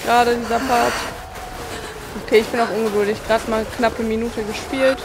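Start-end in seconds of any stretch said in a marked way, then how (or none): none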